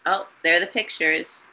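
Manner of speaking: ordinary talk, nothing marked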